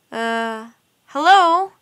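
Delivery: calling into an empty room, rising hello. A narrator's reading. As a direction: Mike calls out into the empty room, a tentative hello. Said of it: "Mike called".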